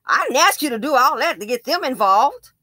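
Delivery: high, loud defensive protest; old woman dropping the act, indignant; sudden indignant snap. Retold or imitated. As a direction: switch to offended and sharp. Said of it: "she changed up that quick".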